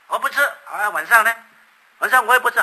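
put-on loud, high sulky refusal from the child; defiant child. Stubborn child loudly refusing to eat to protest his parents. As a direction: shout it back like a stubborn kid refusing dinner.

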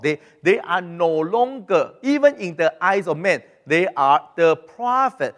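ordinary talk

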